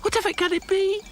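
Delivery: high, fearful question; whimpering. A narrator's reading. Lucy frightened by the growing bump, whimpering high. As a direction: tremble the question. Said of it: "she whimpered".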